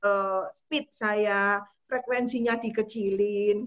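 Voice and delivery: very slow, drawn out. Shown as a demonstration. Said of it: "saya harus memperlambat".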